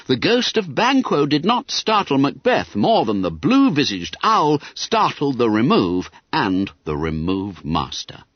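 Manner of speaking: ordinary delivery, nothing marked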